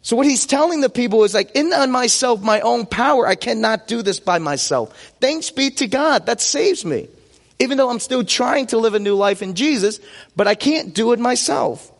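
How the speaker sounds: normal talking voice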